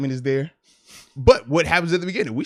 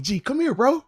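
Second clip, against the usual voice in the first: calling over; 10 semitones higher than usual. Retold or imitated. Calling his upset son over.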